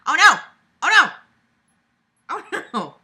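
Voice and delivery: loud, high play-alarmed cry; mock alarm. Real, not acted. Playing with the dog, loud mock panic. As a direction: yelp it in pretend panic.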